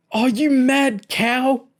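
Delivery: very high, slow, loud howl. A narrator's reading. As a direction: howl in pained outrage. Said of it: "James howled".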